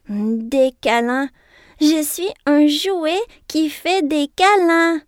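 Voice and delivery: loud, slow, joyful discovery; light up with joy; delighted. A narrator's reading. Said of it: "se réjouit Double Doux".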